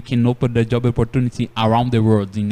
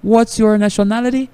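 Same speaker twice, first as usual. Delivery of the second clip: cheerful question; 9 semitones higher, 6 dB louder than usual. Retold or imitated. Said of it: "A primeira coisa que eu falo assim".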